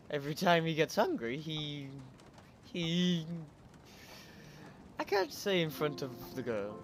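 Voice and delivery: ordinary talk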